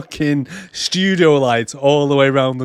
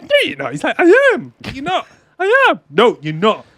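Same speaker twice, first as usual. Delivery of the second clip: much higher, squeaky sing-song quarrel; mock childish bickering; 3 dB louder than usual. Marnie and Job argue over the lawnmower man: high, squeaky bickering. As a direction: squeal the back-and-forth like kids. Childish back-and-forth argument.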